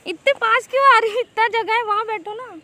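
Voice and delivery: loud protest. Real, not acted. Woman to the pushy stranger sitting too close: loud protest.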